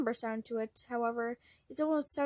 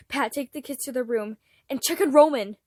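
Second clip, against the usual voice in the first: urgent order rising to a loud shout; near scream; 8 dB louder than usual. Acted. Logan ordering Pat to take the kids away, near screaming. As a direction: snap out orders, panic building. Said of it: "Logan nearly screamed".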